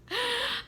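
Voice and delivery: short high-pitched fake sob; mock crying. Student crying in the office, imitated high wail. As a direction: let out a quick pretend wail. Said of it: "I started crying".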